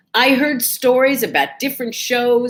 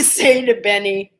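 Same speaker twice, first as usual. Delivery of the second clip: usual speaking voice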